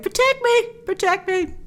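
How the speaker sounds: high, whiny mock cry for protection; mock plea for protection. Commentator mocking a villain hiding behind Wonder Woman, high whiny plea. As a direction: ham up a cowering villain begging to be saved. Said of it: "he's like".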